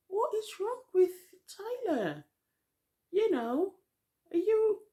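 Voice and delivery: high, loud incredulous squeal; squeaky disbelief, stretched and halting. Retold or imitated. Viewer defending Tyler to the screen, incredulous. Incredulous retelling of own reaction.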